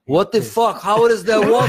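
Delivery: high, loud, outraged disbelief; hammed-up bewildered neighbor shouting; baffled Armenian neighbor. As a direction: yell up like a furious old man who can't work it out. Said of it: "he heard the water".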